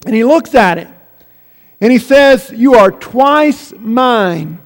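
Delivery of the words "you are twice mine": each word drawn out slowly; lingering over the words, a touch of pride; stretched-out, mildly emphatic; slow, savoring. Acted. Boy speaks to his boat.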